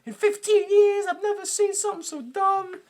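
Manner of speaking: high-pitched mock tirade; ranting dad. Dad scolds his son while driving, high ranting imitation. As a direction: rant in a strained, high voice. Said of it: "the dad takes Max".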